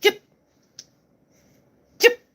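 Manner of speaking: short imitated yips, spaced apart; small-dog yip. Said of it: "his dog just does this".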